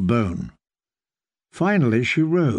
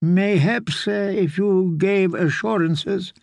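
hesitant, higher-pitched lord; wavering agreement, feeling for the words; uncertain; 5 semitones higher, slower than usual. A narrator's reading. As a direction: falter through a tentative concession. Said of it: "Lord Redford said uncertainly".

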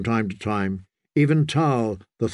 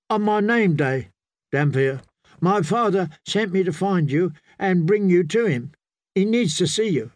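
higher-pitched youthful voice; earnest young messenger; 5 semitones higher than usual. Acted. Young lord's son to the priest, earnest and higher-pitched. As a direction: deliver it as a dutiful youth.

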